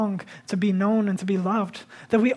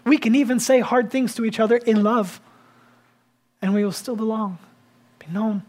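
ordinary delivery

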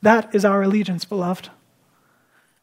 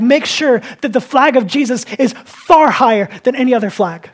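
raised, emphatic sermon delivery; fervent preaching; 3 semitones higher, 6 dB louder than usual. Real, not acted. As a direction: exhort the congregation with conviction. Preaches at full force.

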